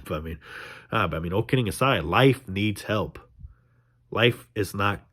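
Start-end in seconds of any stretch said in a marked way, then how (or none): 2.0-3.2 each word slow and weighted; slow emphatic. Real, not acted. Vlogger insisting life needs help, slow and weighted. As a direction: lay it down slowly, every word.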